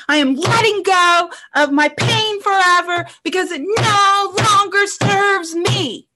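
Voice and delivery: high, forceful scream, last words stretched; drawn-out cathartic yell; screamed declaration. Shown as a demonstration. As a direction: scream it out with full conviction. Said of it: "you just, like, scream it".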